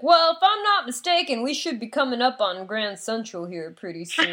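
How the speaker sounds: mock Southern accent, opening high. Self-styled New York authority guiding the kid, mock Southern accent. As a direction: imitate a know-it-all with a Southern accent. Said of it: "like, a Southern accent".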